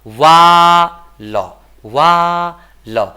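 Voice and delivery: वाल stretched very slowly and loud, long आ; drawn-out Wall. Shown as a demonstration. Teacher modelling वाल for the class, stretched and loud. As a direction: drag out the आ in वाल. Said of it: "क्या पढ़ेंगे?".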